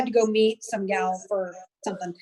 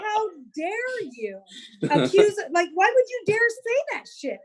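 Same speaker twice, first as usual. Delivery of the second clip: slow then higher, scandalized; retold indignation; 6 semitones higher than usual. Retelling fight with husband, slow indignant then higher. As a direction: drag out how dare you, then rise in outrage. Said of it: "And I was pissed".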